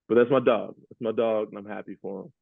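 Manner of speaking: ordinary talk, nothing marked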